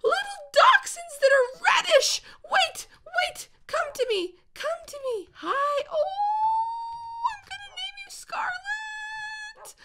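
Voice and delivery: very high squealing coo. Real, not acted. Gamer names a new puppy Scarlet, squealing coo. Coos at red dachshund.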